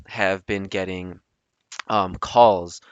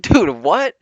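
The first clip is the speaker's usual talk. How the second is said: higher, louder baffled reply; taken aback; 8 semitones higher, 7 dB louder than usual. Retold or imitated. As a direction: react in startled disbelief. Startled reply to the swearing.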